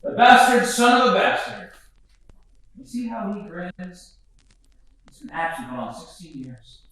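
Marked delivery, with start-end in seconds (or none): 0.0-1.7 loud, higher bitter cry; bitter shout about the father; bitter outburst. Acted. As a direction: spit it out bitterly.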